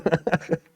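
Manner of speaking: short laugh at an absurd figure; breathy little burst of laughter; amused laugh. Real, not acted. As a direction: chuckle in disbelief.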